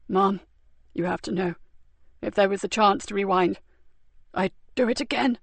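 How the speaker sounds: weak and wounded. A narrator's reading. Wounded man, soft.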